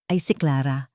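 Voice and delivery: ordinary delivery, nothing marked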